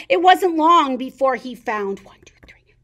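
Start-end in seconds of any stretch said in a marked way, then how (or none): 2.0-2.7 whispered suspense; tense whisper. A narrator's reading. Narrator counting sleeping mice for kids, whispered. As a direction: whisper so the mice stay asleep.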